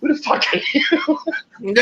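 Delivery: high, slow, laughing through the question; cracking up while asking who he is; laughing disbelief. Retold or imitated. Retold confused reply.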